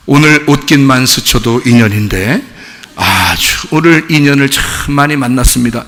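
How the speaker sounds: low, calm monk voice; imitating a serene Buddhist monk; praying monk. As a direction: intone it evenly like a monk at prayer. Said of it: "그 스님은 절에 가서 어떻게 그 불공을 드리냐면".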